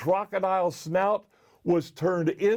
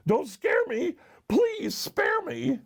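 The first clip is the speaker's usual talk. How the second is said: high-pitched panicky begging; frightened elephant begging for mercy; terrified pleading; 10 semitones higher than usual. A narrator's reading.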